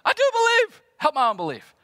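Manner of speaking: father exclaims. Acted. Father crying to Jesus for his son, desperate shout. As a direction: cry it out at the top of your voice. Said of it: "the boy's father then exclaims".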